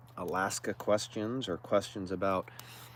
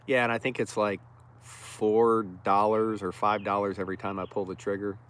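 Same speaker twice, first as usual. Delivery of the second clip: normal talking voice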